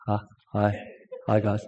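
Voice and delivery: a mumbled, deflated hello, low and quiet; Bruce. Retold or imitated. Husband greeting viewers reluctantly, low and quiet. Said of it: "So he goes".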